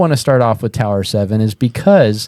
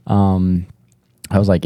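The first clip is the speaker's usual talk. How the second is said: ordinary talk, nothing marked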